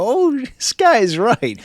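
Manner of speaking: high, very slow, dawning "oh" of agreement; imagined listener's realization. Pretend. Listener hearing the beat again realizes he was right, high and slow. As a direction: stretch it out up high like it finally clicked. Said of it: "and you're gonna go".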